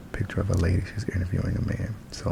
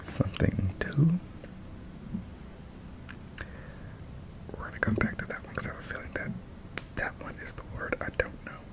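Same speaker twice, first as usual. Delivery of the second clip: whispering; 7 semitones higher, 9 dB quieter than usual. Real, not acted. Whispered homework.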